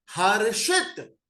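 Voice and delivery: slow हर्षित with its last part stressed; sounding out हर्षित. Shown as a demonstration. Teacher stretches हर्षित to show शित. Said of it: "तो last में क्या बन रहा है".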